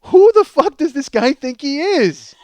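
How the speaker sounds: ordinary delivery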